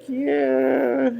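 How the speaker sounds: high, drawn-out "yeah"; dismayed yeah. Retold or imitated. Daughter reacting to mom's hair loss, drawn-out dismay. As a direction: stretch it out high, in mock dismay.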